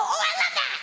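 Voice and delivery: very high squealed delight; sex voice. Shown as a demonstration. Comic demonstrating what she says during sex, squealy. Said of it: "this is my sex voice".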